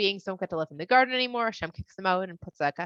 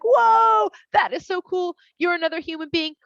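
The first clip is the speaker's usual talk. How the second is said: much higher and louder, thrilled; amazed man; 10 semitones higher, 7 dB louder than usual. Acted. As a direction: gush at the new discovery like a kid. Man amazed by new woman.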